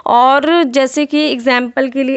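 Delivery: ordinary talk, nothing marked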